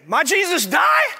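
imitated toddler shouting, very high, rising; loud child's question. Son calls out to dad in church, loud and childlike. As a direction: shout it like an insistent small boy. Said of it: "He did it again".

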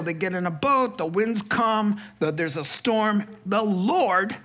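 normal talking voice

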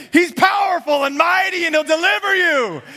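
high, loud booming preacher proclamation; mock triumphant preacher. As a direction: ham up the big churchy promise. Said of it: "you're supposed to say".

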